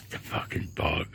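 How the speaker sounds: exasperated whisper; whispered curse. Real, not acted. Man filming curses the returning bug in a whisper. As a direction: hiss it under your breath, fed up.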